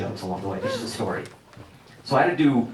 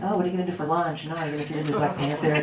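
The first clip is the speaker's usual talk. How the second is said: breezy chatter; 5 semitones higher, faster than usual. Retold or imitated. Mocking casual agents.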